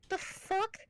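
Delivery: baffled inner character breaking out; Doc Ock. Retold or imitated. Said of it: "Doc Ock takes over. I hear that voice".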